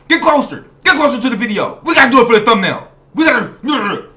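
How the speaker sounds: loud, pushy mother's demands; mock bossy mom. Host imitates the mother ordering her son for the thumbnail, loud. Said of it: "imitating the mother".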